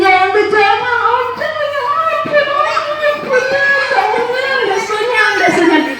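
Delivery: very high wailing for mercy, slowing at the end; crying, begging. Acted. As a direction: sob out the pleas. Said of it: "dia menangis".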